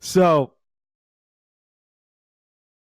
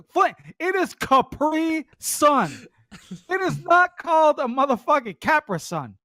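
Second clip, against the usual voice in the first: exasperated correction; 11 semitones higher than usual. Retold or imitated. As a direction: spell it out in disbelief.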